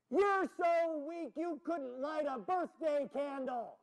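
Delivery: high, drawn-out slow taunt. Acted. Jack calling down the tunnel to provoke the dragon, taunting. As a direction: stretch every word to mock the dragon.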